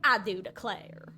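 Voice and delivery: slow Southern cowboy accent. Shown as a demonstration. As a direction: draw it out like a cowboy. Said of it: "Southern Belle phrases with the more cowboy-esque accent".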